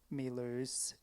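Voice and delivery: put-on rolling Scottish accent on the title. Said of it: "in my Scottish accent".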